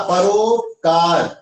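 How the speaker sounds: परोपकार said slowly, syllable by syllable; teacher dictating परोपकार at a slow pace; slow dictation. Shown as a demonstration. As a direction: spell out परोपकार slowly for the class. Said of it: "इसको solve करना है।".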